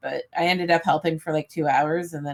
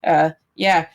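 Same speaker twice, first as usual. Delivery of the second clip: ordinary delivery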